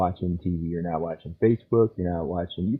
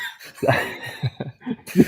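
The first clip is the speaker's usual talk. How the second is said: breathy unvoiced laugh, rising then falling; real laugh. Real, not acted. Caught laughing at his own man cave joke, breathy laugh.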